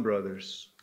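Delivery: ordinary delivery, nothing marked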